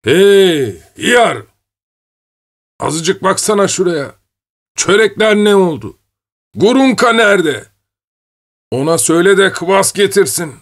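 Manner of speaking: calling out loud. Acted. Old man yelling outside for Hıyar, loud and high. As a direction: bellow across the yard to the servant. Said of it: "sesini yükselterek dışarıya bağırdı".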